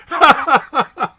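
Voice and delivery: loud burst of real laughter, pitched high; big laugh. Real, not acted. Host cracks up at chat joke.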